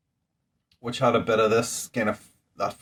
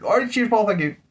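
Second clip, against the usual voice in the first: bright, much higher cheers to a friend; redone thanks, pitched much higher; 12 semitones higher, 4 dB louder than usual. Shown as a demonstration. Retaking his thanks to Paul for the beer, pitched much higher. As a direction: redo the thanks with the voice lifted well up. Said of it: "Sounded a bit weird".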